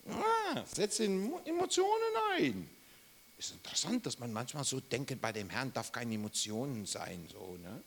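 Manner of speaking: ordinary delivery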